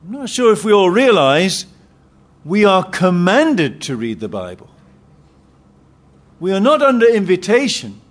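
normal talking voice

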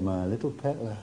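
low, very soft, fading to a whisper on arise; Jesus softly waking the girl; hushed tender. Acted. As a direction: coax her awake gently. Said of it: "he said".

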